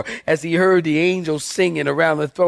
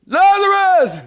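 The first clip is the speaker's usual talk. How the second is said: very high, louder, stretched-out holler; hammed-up full-force summons; screamed call; 13 semitones higher, 5 dB louder than usual. Preacher repeats Jesus' call to Lazarus, hammed-up scream. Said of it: "I gotta make that face".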